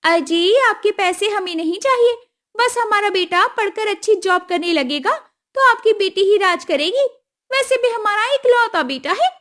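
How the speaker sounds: high, brisk voice of a scheming mother-in-law; groom's mother. Acted. Groom's mother Kusum sweet-talking Ramu, high and brisk. Kusum bargaining over dowry.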